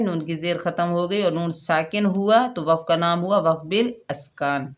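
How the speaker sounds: normal talking voice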